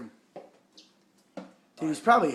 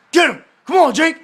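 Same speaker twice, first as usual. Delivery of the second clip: high, loud urging shout; 11 semitones higher, 14 dB louder than usual. Real, not acted. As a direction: egg the dog on, yelling. Urging the dog on.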